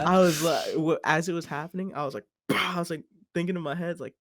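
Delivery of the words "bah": sudden mock retch; imitated vomiting noise. Storyteller acting out throwing up into the toilet, mock retch. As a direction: burst out a projectile-puke sound.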